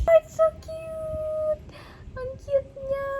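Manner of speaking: very high, drawn-out cooing squeal; gushing squeal. Real, not acted. Vlogger seeing the rabbit figure, gushing squeal. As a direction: gush over something adorable, pitch way up.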